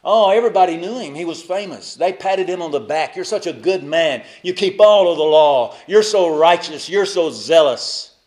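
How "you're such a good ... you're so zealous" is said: louder, gushing mock admiration; flattering praise. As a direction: heap praise on him, a bit too loud. Crowd flattering Saul.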